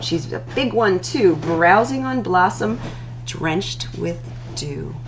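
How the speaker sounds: ordinary delivery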